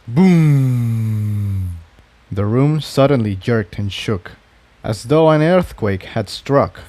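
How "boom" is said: boom effect. A narrator's reading. Narrated explosion.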